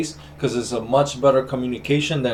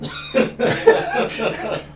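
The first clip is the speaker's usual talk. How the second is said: high laugh. Real, not acted. Sees licenses on the wall.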